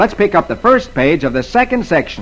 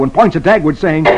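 usual speaking voice

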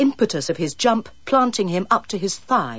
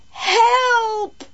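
high, desperate shout; shout for help; 4 dB louder than usual. A narrator's reading. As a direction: yell it out for help. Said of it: "shouted Carol".

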